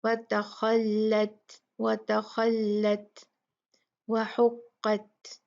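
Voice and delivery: व तख़ल्लत with a light त, व हुक़्क़त with a heavy क़; slow careful recitation; slow tajweed recitation. Shown as a demonstration. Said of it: "ता light है लेकिन क़ाफ heavy है".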